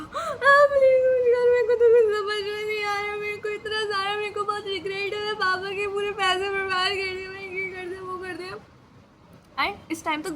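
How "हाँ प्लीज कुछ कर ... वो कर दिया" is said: loud, high sobbing plea; begging her friend for help while crying. Retold or imitated. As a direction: wail it through tears. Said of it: "मैं कहा".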